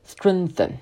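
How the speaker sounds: "Strengthen" with tongue between the teeth, pushed back; slow model "Strengthen", th bitten; th demo. Shown as a demonstration. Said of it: "Try to follow with me". (跟读示范"Strengthen"，咬舌)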